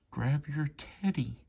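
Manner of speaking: plain reading voice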